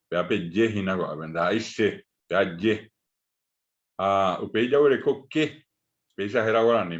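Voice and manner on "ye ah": ordinary talk, nothing marked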